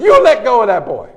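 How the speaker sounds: put-on high, loud scolding old lady; hammed-up granny shouting an order; little old lady. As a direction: yell it as a fierce neighborhood grandmother. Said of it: "this little old lady comes and says".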